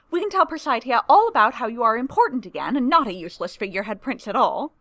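chatty and pleased with herself; eager young woman voice. A narrator's reading. Tamara's eager voice.

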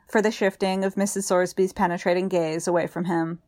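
ordinary delivery

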